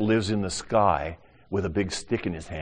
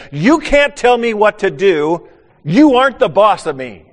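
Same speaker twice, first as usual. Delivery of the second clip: put-on defiant child; 12 semitones higher, 13 dB louder than usual. Little boy defying the preacher, loud defiant shout. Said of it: "in a very, uh, forceful way".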